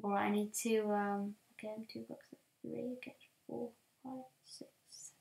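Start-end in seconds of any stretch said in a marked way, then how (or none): 1.5-5.1 whispered counting. Real, not acted. Counting blocks.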